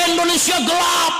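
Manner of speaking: high shouted slogan; mock protest cry. Preacher voicing the students shouting their slogan.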